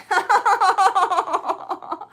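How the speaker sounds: loud high laugh as the card goes flying; burst of laughter. Real, not acted. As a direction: laugh out loud, caught off guard.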